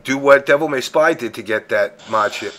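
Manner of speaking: normal talking voice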